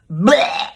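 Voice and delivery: a loud spitting "Blech!" of disgust. Acted. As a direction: spit out a loud "Blech!" like tasting something foul. Disgust at the bad story.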